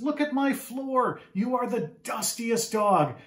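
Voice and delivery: high, slow, exasperated scold; a mom dismayed at her floor. Acted. Mom scolding the dusty dog, high and slow. Mom sees dirt tracked in.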